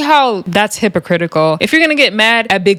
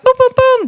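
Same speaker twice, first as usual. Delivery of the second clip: high, punchy booms; imitated punches. Recapper acting out Fana swinging on Summer, high imitated punches. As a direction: sound out the blows, pitched way up.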